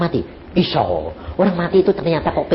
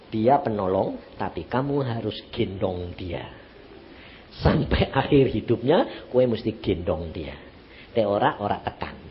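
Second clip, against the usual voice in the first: low, soft, slow instruction; calm voice of God; 8 semitones lower, 7 dB quieter than usual. Retold or imitated. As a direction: lay it down gently and slowly, as God speaking. Quotes God's quiet instruction to him.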